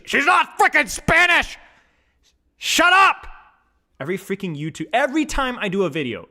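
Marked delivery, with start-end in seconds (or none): 0.0-3.3 shouting, much higher and louder; outraged rant at the comments; frustrated shout. Real, not acted. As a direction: yell it out in exasperation. Ranting at commenters.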